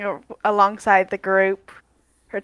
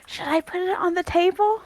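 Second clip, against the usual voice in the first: hushed, higher-pitched question; light stage whisper, rising at the end; 8 semitones higher, 5 dB quieter than usual. Acted. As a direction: ask it softly, voice lifted, as a discreet aside. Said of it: "and Sage whispers".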